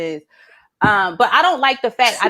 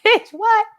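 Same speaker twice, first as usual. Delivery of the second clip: incredulous; 11 semitones higher than usual. Retold or imitated. Incredulous retort.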